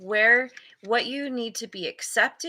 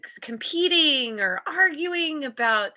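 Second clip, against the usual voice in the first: normal talking voice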